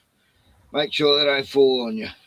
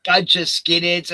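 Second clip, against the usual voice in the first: usual speaking voice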